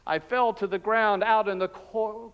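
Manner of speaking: ordinary talk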